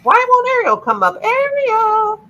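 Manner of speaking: high-pitched calling voice. Real, not acted. Calling Ariel who won't come up, high call.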